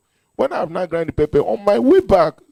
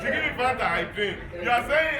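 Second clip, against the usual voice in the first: usual speaking voice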